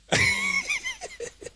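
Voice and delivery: high breathy burst of laughter; can't stop laughing; helpless high laugh. Real, not acted. Preacher to congregation, high helpless laugh.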